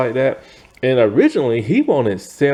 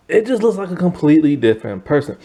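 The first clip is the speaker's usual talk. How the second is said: usual speaking voice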